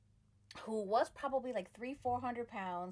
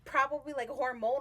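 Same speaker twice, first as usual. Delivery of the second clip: ordinary delivery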